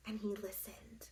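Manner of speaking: hushed storytelling whisper. A narrator's reading. Narrator pauses the story in a whisper. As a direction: whisper it to build suspense.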